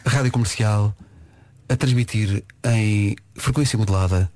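put-on deep old-school radio announcer. Shown as a demonstration. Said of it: "Estás a fazer voz de rádio".

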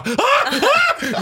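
high panicked gasps with a laugh; shaky panic. Retold or imitated. Smoker in mock panic, high laughing gasps. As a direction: gasp in mock withdrawal panic. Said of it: "Jag började skaka".

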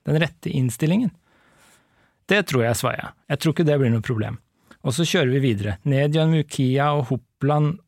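measured read-aloud narration; story reading. Acted. Host reads the book's ending to co-host, story reading. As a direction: read the novel out clearly and evenly.

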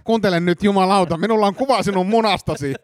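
high-pitched, insistent blackmailer; epäilty, uhkaava. Acted. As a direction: corner the inspector with a threat. Suspect threatens the inspector. (uhkaava, kiihtynyt epäilty)

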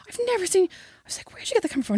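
high, hushed disbelief; taken aback. Retold or imitated. Retelling how she questioned her boyfriend's camera, baffled. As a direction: retell your astonished question, voice high then dropping away.

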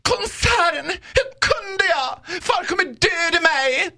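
very high, slow, mock-tearful mermaid; hammed-up cartoon heroine wailing in a high, drawn-out voice; Lilla Sjöjungfrun. Mermaid dreading her father in an imitation battle, high mock-despairing wail. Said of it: "Jag kan också imitera, vad heter det, Lilla Sjöjungfrun?".

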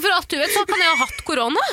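high, giggling delivery of the quote; laughing through words. Real, not acted. Woman laughing as she retells her retort. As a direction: say it while cracking up.

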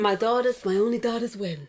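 lightly voiced Italian Godfather; playful Godfather-style Italian accent; mock Italian mobster. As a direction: echo it in fake Italian. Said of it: "The kid just is a whole Godfather".

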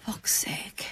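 breathy whispered swear; whispered curse. Real, not acted. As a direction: hush the disappointment under your breath. Letdown at the answer.

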